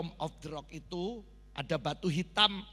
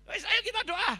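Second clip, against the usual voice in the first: high, loud retold urging; rallying call; 7 dB louder than usual. Pastor retelling how he urged the bunker to pray, loud high rallying call. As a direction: call a frightened group to pray.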